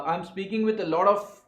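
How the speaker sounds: ordinary delivery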